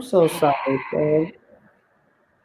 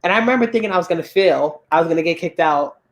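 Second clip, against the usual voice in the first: normal talking voice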